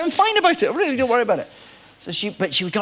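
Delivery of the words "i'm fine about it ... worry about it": high-pitched, light brushing-off of concern; retold reassurance. Actor reassuring his annoyed co-star, high-pitched reassurance. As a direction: reassure them in a raised, insistent pitch.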